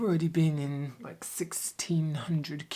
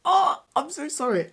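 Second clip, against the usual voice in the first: high, loud rushed apology; breathless apology; 10 semitones higher, 7 dB louder than usual. Real, not acted. Vlogger returning after a PS5 queue win, excited apology. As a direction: burst back in apologising.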